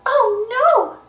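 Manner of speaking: high cartoon wail of alarm; acted high-pitched alarm; dismayed cry. A narrator's reading. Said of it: "cries Daisy".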